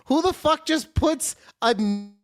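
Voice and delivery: ordinary delivery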